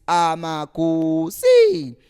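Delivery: Amakusi stretched slow and loud; with passion. Real, not acted. Host proudly naming the club, drawn out. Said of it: "I can even say that with passion still".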